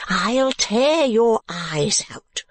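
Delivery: high, slow, stretched-out shriek; screamed threat. A narrator's reading. Woman threatening Mantalini, screaming slowly. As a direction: screech the threat, drawing out the words. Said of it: "screamed the woman".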